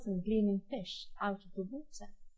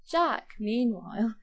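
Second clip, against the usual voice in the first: ordinary delivery, nothing marked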